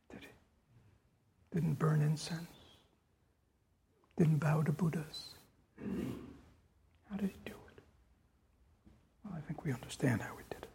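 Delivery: hushed rhetorical whisper. Real, not acted. Teacher to students, hushed whisper. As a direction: lean in and breathe the words.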